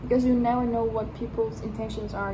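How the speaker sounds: usual speaking voice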